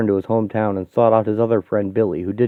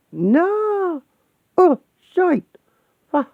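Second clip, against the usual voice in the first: high-pitched terrified yell; panicked scream; much slower than usual. Acted. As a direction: scream it as if falling off the edge. Pushed to jump off the edge.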